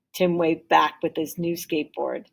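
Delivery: ordinary delivery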